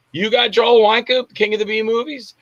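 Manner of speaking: lightly imitating an astonished friend; amazed question. Garland amazed on phone.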